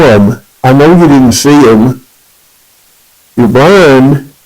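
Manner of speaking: challenging. Retold or imitated. Alvin challenges the blind drummer.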